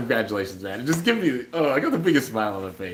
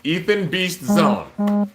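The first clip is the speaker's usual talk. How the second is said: higher and very slowly drawn out, like a big reveal; a hyped-up, long-drawn winner announcement; dramatic winner reveal; 8 semitones higher, 4 dB louder, much slower than usual. Shown as a demonstration. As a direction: stretch out every syllable of the name like a game-show host. Your name is Ethan Beast Zone.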